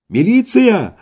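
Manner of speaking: high shout into the phone. A narrator's reading. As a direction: call out for police. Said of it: "закричал Иван в трубку". (крик в трубку)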